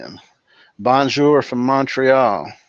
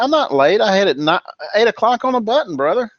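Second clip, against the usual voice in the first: higher, fast indignant protest; mock defensive retort; 8 semitones higher, faster than usual. Host answers chat's jab about lateness, mock indignant. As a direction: play the wronged latecomer.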